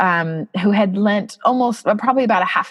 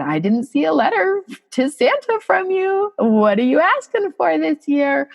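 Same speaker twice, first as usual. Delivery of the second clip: bright, playful mom voice pitched higher; gentle teasing; 8 semitones higher than usual. Retold or imitated. Mother teasing her son about his Santa letter, playful high lilt. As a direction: tease your son lightly about Santa. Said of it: "kind of teasing".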